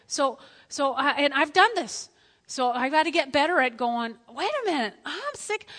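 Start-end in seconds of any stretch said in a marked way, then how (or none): 4.3-5.7 higher-pitched dawning protest; mock realisation. Preacher acting out noticing his own sickness, surprised. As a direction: catch yourself, pitch jumping up. Acts out sudden self-realisation.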